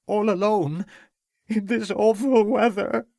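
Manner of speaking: tearful, building. A narrator's reading. Cook fearing for the young master, rising tearful and slowing. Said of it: "giving a loud sob".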